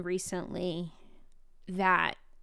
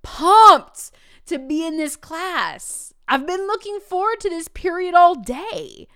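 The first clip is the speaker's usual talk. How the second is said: higher and louder, stretched-out thrilled voice; excited kid; 7 semitones higher, 9 dB louder, slower than usual. Retold or imitated. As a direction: gush like a kid who can't wait for class. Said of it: "the kid turned around and he's like".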